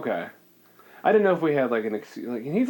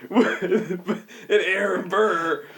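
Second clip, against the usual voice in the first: usual speaking voice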